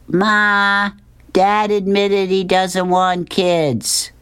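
high, drawn-out tattling call. Acted. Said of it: "Curtis yells out".

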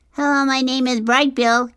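loud, very high-pitched little gosling; high, eager young creature calling out; shouting gosling. A narrator's reading. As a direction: shout a greeting over the waves. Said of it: "he shouted over the waves".

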